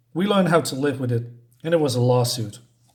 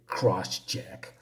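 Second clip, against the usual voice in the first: low, quiet villainous sneer on the name; 7 semitones lower, 8 dB quieter than usual. Retold or imitated. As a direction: hiss the name like a mocking villain. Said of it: "She said".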